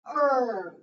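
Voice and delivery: "er" held long and loud; loud, isolated "er" for the class; letter sound demo. Shown as a demonstration. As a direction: model "er" as one drawn-out r-coloured vowel. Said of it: "it will be read".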